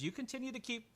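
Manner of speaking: high put-on woman's voice; Jenny instructing. Woman talking to the man in the bath, high put-on voice. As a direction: instruct in a high, friendly character voice. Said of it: "She goes".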